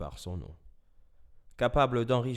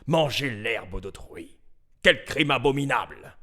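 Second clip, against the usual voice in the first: loud, indignant cry building to a peak; outraged condemnation; 4 semitones higher, 8 dB louder than usual. A narrator's reading. Animal court condemning the donkey, loud outrage. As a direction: denounce it loudly as a horrendous crime.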